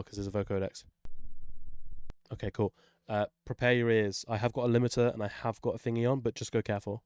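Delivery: usual speaking voice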